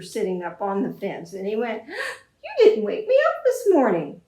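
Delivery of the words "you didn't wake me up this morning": complaining farmer. Acted. Farmer scolds rooster.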